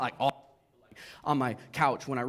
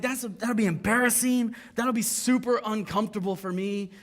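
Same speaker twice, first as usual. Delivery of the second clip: high, louder comic pleading; mock whiny plea; 6 semitones higher, 5 dB louder than usual. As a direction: beg out of it, higher and louder. Retold plea to God, embarrassed.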